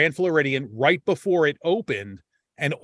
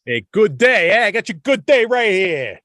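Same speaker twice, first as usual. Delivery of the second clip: loud, much higher mock Long Island tough guy; hammed-up Long Island heckle, louder; tough Long Island accent; 6 semitones higher, 7 dB louder than usual. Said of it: "with my thick Long Island accent".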